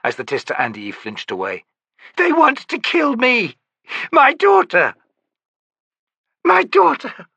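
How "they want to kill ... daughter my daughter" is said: high, loud, frantic screams; terrified mother screaming for her daughter; screaming in terror. A narrator's reading. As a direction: shriek it, rising in panic. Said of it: "she screamed".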